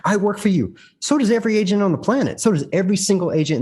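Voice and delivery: high, fast indignant scoff; a touch of mocking outrage. Quoting agent, then scoffing.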